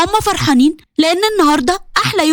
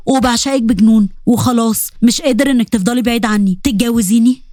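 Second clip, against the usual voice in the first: Omar proposing; 6 semitones lower than usual. Acted. Omar proposing to Bushra, low tender voice. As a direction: lower young man's voice, tender and eager.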